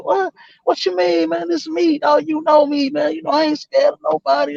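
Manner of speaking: imitating his high-pitched, insistent denial; indignant protest. Retold protest at being called scared.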